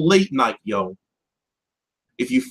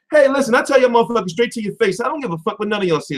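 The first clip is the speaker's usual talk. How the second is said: ordinary talk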